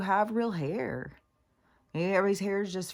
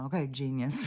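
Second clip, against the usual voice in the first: snide; 4 semitones lower, 3 dB quieter than usual. Retold or imitated. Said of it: "I did say some kind of snide thing".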